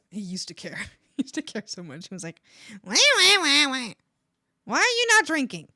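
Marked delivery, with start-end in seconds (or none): 2.8-5.7 loud, high whining why; whiny pestering dad. Retold or imitated. Dad nagging daughter about not drinking, loud whine. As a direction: nag like a child begging.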